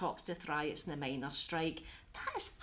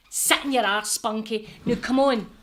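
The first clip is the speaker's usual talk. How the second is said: loud, bossy telling-off; loud command; 10 dB louder than usual. Acted. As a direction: order him to sit, loudly. Therapist snaps back, commanding.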